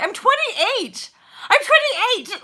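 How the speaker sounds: mock-offended yell of the age; indignant insistence. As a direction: insist on your age, outraged. Insisting on her real age.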